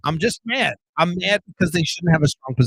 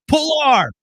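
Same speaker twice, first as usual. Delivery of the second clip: hammed-up higher shout of a slogan; mock party-line battle cry; mock rallying shout; 11 semitones higher, 5 dB louder than usual. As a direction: yell it like a mock party chant. Mocking his old partisan self.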